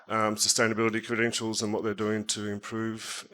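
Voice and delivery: usual speaking voice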